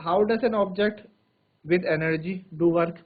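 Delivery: very slow, each phrase spaced out; slow dictation. Shown as a demonstration. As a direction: dictate slowly for notes. Dictating a question. (धीरे-धीरे लिखवाना)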